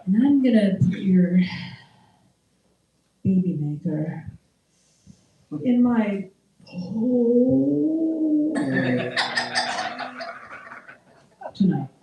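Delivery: practice sounding filthy, hammed up; mock dirty talk. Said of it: "practice by talking dirty to yourself".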